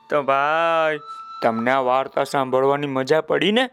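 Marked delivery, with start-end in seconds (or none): none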